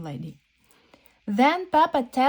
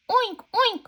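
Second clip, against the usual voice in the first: high squeaky oink oink; piglet oinks; 13 semitones higher, 3 dB louder than usual. Acted. George answering Peppa with high oinks.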